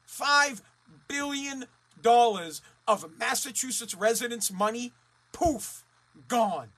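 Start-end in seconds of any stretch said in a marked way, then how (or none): none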